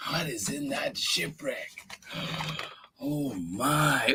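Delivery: soft secretive whisper; curious whisper. Acted. Peeking into the shipwreck.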